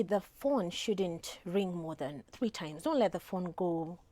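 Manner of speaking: usual speaking voice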